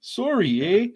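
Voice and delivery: a high, playful Canadian "Sorry, eh?"; mock Canadian "Sorry, eh?" among friends; Canadian accent. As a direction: ham up a Canadian apology. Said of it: "accent on the sorry".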